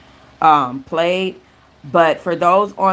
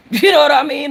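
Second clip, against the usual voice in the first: laughing; 10 semitones higher, 5 dB louder, faster than usual. Real, not acted. Laughing right after the quote.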